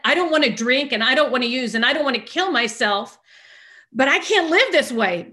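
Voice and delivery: high, loud, insistent repeat; exasperated inner protest. Retold or imitated. Silently fuming at her calm sponsor, exasperated. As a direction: hammer each complaint in disbelief. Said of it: "And I'm thinking, "Did she just hear what I said?".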